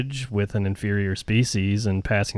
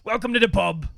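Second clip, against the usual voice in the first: much higher, hammed-up British accent; a silly British barman voice; mock British bartender; 12 semitones higher than usual. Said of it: "pretending to be the bartender".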